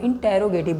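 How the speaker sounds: इंटेरोगेटिव with a full "te" syllable, "in-te-ro"; corrected pronunciation इंटेरोगेटिव. Shown as a demonstration. Teacher correcting students' word for a question sentence, pronounced "in-te-ro-gative". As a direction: say इंटेरोगेटिव as in-te-ro-ge-tiv. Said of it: "इसको इंट्रोगेटिव मत बोलो".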